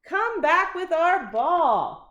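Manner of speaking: high, loud, slow shout; loud childish plea. A narrator's reading. Kids shouting for the dog to return the ball. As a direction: demand the ball back.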